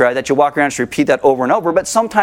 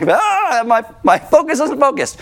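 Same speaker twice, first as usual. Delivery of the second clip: high, flustered mock voice; distracted worshipper; 8 semitones higher than usual. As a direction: sound scattered and fretful.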